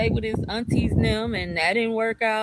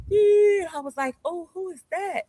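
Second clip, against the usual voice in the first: dramatic; 10 semitones higher than usual. Retold or imitated. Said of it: "I'm being very dramatic".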